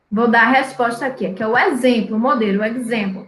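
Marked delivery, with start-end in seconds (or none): none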